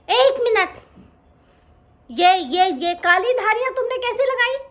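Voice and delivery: high, loud startled question; a small cub gaping at the stripes; amazed little lion cub; surprised cub. Acted.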